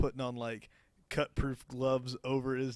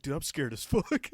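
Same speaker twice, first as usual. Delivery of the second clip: mock scared; 3 semitones higher, 6 dB louder than usual. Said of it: "He's like".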